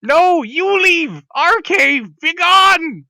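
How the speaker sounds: high, loud, stretched-out screech; screaming kobold. Acted. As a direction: shriek back as a territorial kobold. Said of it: "they're all screaming".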